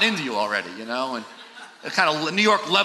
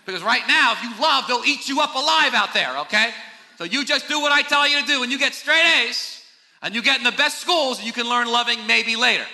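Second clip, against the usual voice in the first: a hint of a pushy parent; 7 semitones higher than usual. Pushy parent voiced to child.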